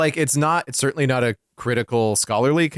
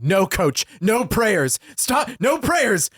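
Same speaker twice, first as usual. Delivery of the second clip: higher-pitched, emphatic refusals, line by line; mock scolding principal; 10 semitones higher than usual. Principal on the phone forbidding the coach prayers, mock scolding. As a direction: lay down the law in a high, insistent voice.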